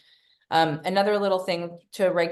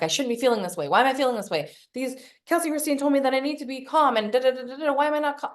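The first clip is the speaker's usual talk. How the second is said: higher-pitched fretful inner voice; mock self-scolding; 7 semitones higher than usual. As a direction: whine through a list of self-criticism. Mocking anxious self-criticism.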